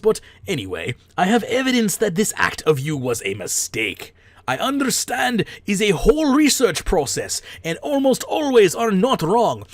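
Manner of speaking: a host ham-reading a forum post in a bad Russian accent. Hammy Russian accent reading a ban appeal aloud. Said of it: "make a funny Russian. ... a bad Russian accent".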